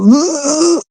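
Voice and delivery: high, disgusted "ugh"; disgusted groan. Retold or imitated. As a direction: spit out a grossed-out ugh. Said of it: "make me go".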